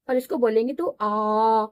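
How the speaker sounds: आ held long, twice the length of अ; long vowel. Shown as a demonstration. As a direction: stretch out the आ. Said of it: "लंबी आवाज आएगी".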